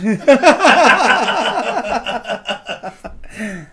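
loud, high, drawn-out laughter; long hearty laugh. Real, not acted. Long laugh after joke.

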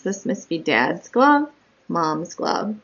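ordinary delivery

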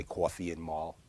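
Long Island "coffee" and "mall", said low and soft; Long Island accent. Shown as a demonstration. Teacher showing his Long Island accent to viewers. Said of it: "eventually get into my Long".

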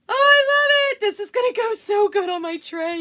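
high, loud delight; thrilled. Real, not acted. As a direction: gush over it. Thrilled with the result.

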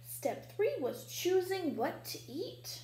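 ordinary delivery, nothing marked

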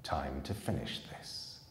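low, quiet, cold threat; a lightly voiced murderer closing in; soft menace over a trapped boy; menacing killer. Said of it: "said the man Jack".

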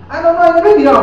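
high, loud mock excuse; mimicked brush-off. Administrator putting off the unpaid worker, mimicked. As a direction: imitate an evasive paymaster, pitched well up. Acts out administrator refusing to pay him.

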